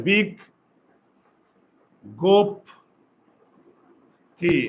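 "বিগ গোপ তি" with long pauses between syllables; broken into syllables. Shown as a demonstration. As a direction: sound out "বিগ গোপ তি" one piece at a time. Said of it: "কি হবে উচ্চারণ লেখার সময়".

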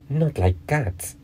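high, drawn-out indignant cry, lightly acted; mildly shrill mouse, slow and high; shrill, passionate. A narrator's reading. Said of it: "in a shrill, passionate voice".

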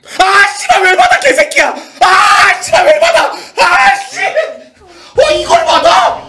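high, loud swearing yell; furious shouting. Real, not acted. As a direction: explode at the opponent. Opponent calls, furious at table.

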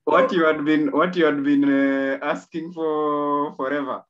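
loud, high amazed reaction. Real, not acted. Host amazed at the story's outcome.

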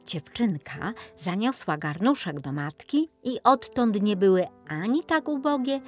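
usual speaking voice

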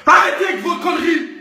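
comic furious rant. Acted. Ranting at a girl who faked her photos, shouting. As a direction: rant like you were cheated.

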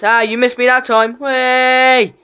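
loud high gloating; taunting shout. Real, not acted. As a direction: gloat loudly at the enemy. Gamer taunting opponent.

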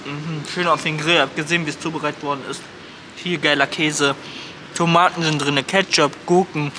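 muffled by sandwich bite; talking while chewing. Real, not acted. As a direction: mouth full of food. Seen 0: Lips pressed, mouth full, chewing throughout after bite at -2.

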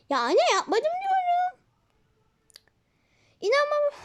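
put-on high, slow whiny child insisting; high childish whine of innocence; child's protest. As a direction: plead like a wronged little kid. Child denies to mother.

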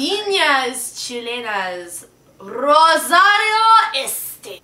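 Viñas Chilenas Rosario said slowly with Spanish vowels and ñ; loud, slow, careful Spanish reading of Viñas Chilenas Rosario; Spanish pronunciation. Shown as a demonstration. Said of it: "Viñas, it has the N".

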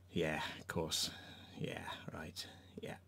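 whispered yeah right, unvoiced; a scoffing whispered aside to himself; sarcastic whisper. Real, not acted. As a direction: breathe it out, no voice, dripping sarcasm.

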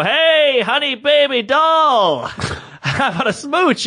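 high, slow smarmy come-on with a laugh; sleazy flirt. Acted. Comic hitting on the waitress at midnight, smarmy and giggling. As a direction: lay on the cheesy charm, chuckling.

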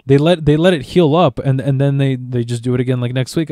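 normal talking voice

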